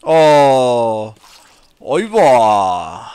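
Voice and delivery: loud surprised outcry; loud startled oh. Real, not acted. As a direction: caught off guard by the game. Startled reaction in game.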